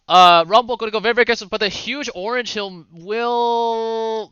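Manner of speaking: quick esports call, easing off; live play-by-play. Acted. Caster to viewers as top lane fight erupts, hurried play-by-play. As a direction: scramble to catch up with the action.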